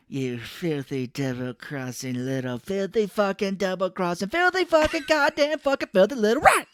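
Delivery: hammed-up rage building to a shriek; frenzied insult rant. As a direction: spit out a string of insults.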